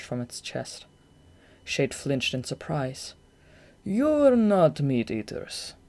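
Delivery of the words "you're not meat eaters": very slow, curious remark; with interest. A narrator's reading. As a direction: Goth noticing Shade doesn't eat meat, interested. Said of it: "Goth said with interest".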